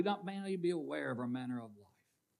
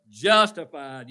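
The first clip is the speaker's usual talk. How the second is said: emphatic answer; 11 dB louder, slower than usual. Real, not acted. Congregation prompted for next word.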